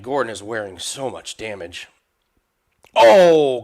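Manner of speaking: usual speaking voice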